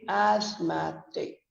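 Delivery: ASTHMATIC drawn out as "az-MAT-ik"; second-syllable stress. Shown as a demonstration. Teacher shows the stress moving, slow ASTHMATIC as "az-MAT-ik". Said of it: "stress moves from the first syllable to the second".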